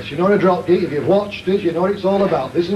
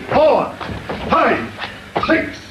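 raised, higher-pitched coach's count; counting out reps; 7 semitones higher, much slower than usual. Real, not acted. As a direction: call each number sharply to drive the trainee. Coach counting out exercise repetitions.

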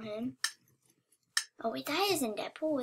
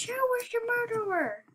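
high, falling, triumphant question; excited crowing; 9 semitones higher than usual. Real, not acted. Triumphant after murderer falls.

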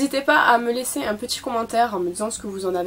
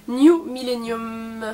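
slow, louder palette name, last syllable held; drawn out; 4 dB louder, much slower than usual. Shown as a demonstration. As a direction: stretch out the name slowly. (nom étiré, très lentement)

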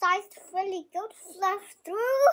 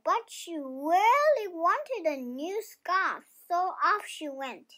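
plain reading voice

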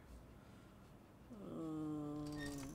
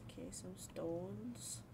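whispering; 5 dB quieter, slower than usual. Acted. Whispered item muttering.

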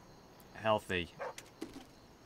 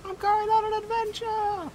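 much higher, loud excited cry; gleeful quote; 5 dB louder than usual. Pretend. Frustrated gamer cheers himself up with a movie line, gleeful. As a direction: squeal it like an eager hobbit.

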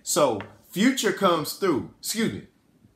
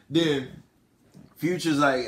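ordinary delivery

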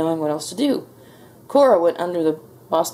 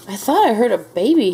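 louder, higher, swooping exclamation; agitated cry; 5 semitones higher, 5 dB louder than usual. A narrator's reading. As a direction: cry out with some alarm. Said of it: "he cried".

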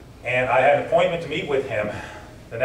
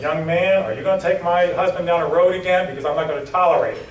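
higher, louder imitation of a stern wife; stern interrogation; 6 semitones higher, 4 dB louder than usual. General's wife grilling the writer, stern and raised voice. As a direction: interrogate like a protective wife, voice pitched up. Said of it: "I can still remember Betts interrogating me".

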